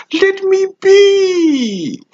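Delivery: high, drawn-out wail of protest; very high, slow, petulant cry; agitated outburst. Acted. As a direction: stretch it into a shrieked plea. Unstable speaker refusing scrutiny.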